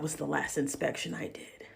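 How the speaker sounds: whispered punchline, under the breath. Real, not acted. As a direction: hush it like a secret.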